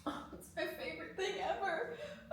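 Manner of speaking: high, soft, giddy squeak; tiny gushing squeal. Real, not acted. Peanut butter lover telling viewers, soft high giddy gush. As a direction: squeak it softly with delight.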